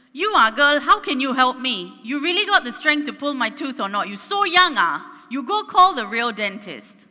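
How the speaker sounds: loud, high Singlish auntie scolding; brash old lady dismissing the young dentist; Singaporean auntie accent. Retold or imitated. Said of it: "and she says".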